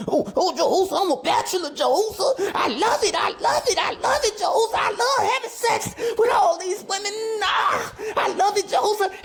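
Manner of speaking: very high, gleeful mock bachelor voice. Host imitating a bragging bachelor, high gleeful mock voice. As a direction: squeal it like a smug playboy bragging. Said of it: "the bachelor".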